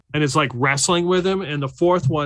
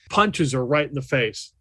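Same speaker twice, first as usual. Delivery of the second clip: ordinary talk, nothing marked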